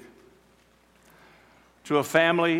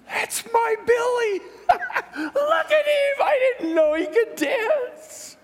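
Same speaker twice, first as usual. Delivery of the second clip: very high, tearful mother's cry of joy; joyful tearful mother; 4 dB louder, slower than usual. Acted. Mother watching her healed son dance, high and tearful.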